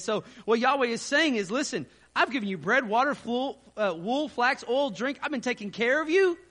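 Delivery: preaching. Acted. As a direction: preach the text with mounting force. Preacher expounds text with force.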